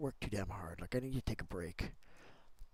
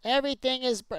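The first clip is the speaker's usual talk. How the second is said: frustrated outburst; 12 semitones higher, 11 dB louder than usual. Real, not acted. Frustrated at a broken build.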